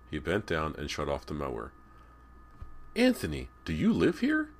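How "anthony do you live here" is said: loud call rising high on the question; high, raised questioning call. A narrator's reading. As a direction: call a question across the yard. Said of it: "Callie called".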